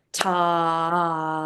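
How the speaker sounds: long held ta; held count syllable. Shown as a demonstration. Teacher counting the whole note on the staff, held ta. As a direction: stretch ta across the measure. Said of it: "counted out like I just did".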